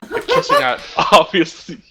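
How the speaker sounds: giggling through the line; laughing. Real, not acted. Reading the fanfic aloud to friends, laughing. Reader cracks up reading smut.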